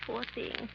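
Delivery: shocked gasp. Acted. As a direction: catch your breath in horror and drop to almost nothing. Horrified reaction to the murder.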